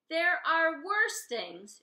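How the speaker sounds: put-on a cook winning an old argument; loud arguing. Saucepan Lynn arguing with the diner regulars, loud and slow.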